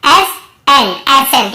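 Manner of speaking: एसेंट drawn out slowly, then said whole; model pronunciation. Shown as a demonstration. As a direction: stretch एसेंट into clear parts, C silent. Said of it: "क्या पढ़ रहे हैं?".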